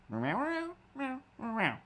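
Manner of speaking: high, playful meowing; silly high kitty meows; cat meows. Pretend. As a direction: answer as the cat. Cat asked to say something.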